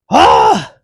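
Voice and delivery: loud, raw scream of rage; real angry scream at the screen; frustrated yell. Real, not acted. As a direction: let out a furious shout. Mid-rant frustrated outburst.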